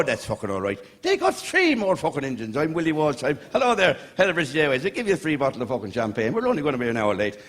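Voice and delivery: ordinary talk, nothing marked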